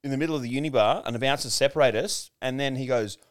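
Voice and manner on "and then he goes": ordinary talk, nothing marked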